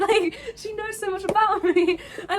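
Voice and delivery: overwhelmed fan, tearful laughing disbelief; laughing while crying. Real, not acted. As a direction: gush through happy tears.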